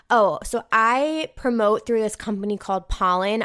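ordinary talk, nothing marked

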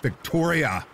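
raging cry, high and strained; wild rage. A narrator's reading. Brother cries out to sister in rage. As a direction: scream her name in fury. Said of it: "cried out with a wild rage".

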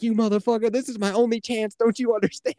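high-pitched mock fury; furious. Game character rages at the player after the turnoff, furious. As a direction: imitate her raging, voice pushed up high. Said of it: "She's fucking furious with you".

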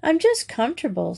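a hint of an exasperating superior schoolgirl; superior air. A narrator's reading. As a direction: toss it off, pleased with yourself. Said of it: "with an exasperatingly superior air".

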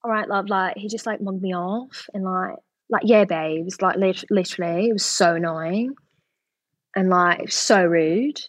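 put-on reality-show British accent; Love Island British accent. Shown as a demonstration. Actress attempting British sound bites for the interviewer, mock Love Island accent. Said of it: "doing sound bites from Love Island".

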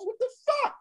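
incredulous. Retold or imitated. Incredulous retold reaction.

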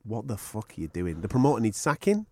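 normal talking voice

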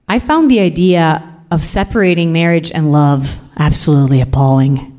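usual speaking voice

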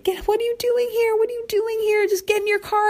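very high, frantic shriek at mom; panicked high-pitched outburst at mom; hysterical. Retold or imitated. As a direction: shriek at her to get out of here. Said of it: "I just went hysterical".

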